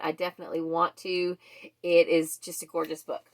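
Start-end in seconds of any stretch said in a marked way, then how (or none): none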